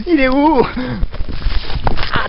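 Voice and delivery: high, loud panicked pleading; scared horror game streamer. Acted. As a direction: beg and scream in terror. Ghost clown is there, pleading.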